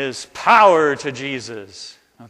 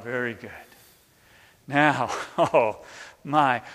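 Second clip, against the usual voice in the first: usual speaking voice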